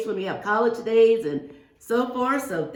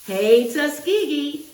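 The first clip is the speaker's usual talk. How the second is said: slow, higher, louder cheer of the school name; rally the kids with the school's name; 6 semitones higher, 5 dB louder, slower than usual. Pretend. Teacher cheering the school to her class, slow rallying call.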